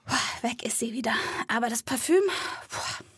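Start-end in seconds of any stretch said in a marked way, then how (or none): none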